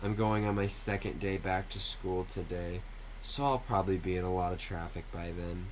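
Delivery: ordinary delivery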